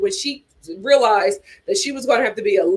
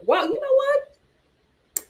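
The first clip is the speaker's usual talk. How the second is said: mock disbelief; 11 semitones higher, 3 dB quieter, much slower than usual.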